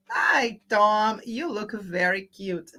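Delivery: ordinary delivery, nothing marked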